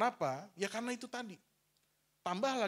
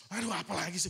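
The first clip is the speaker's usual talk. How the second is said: annoyed retort; 2 semitones higher, 6 dB louder than usual. Retold or imitated. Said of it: "Dulu saya keluar".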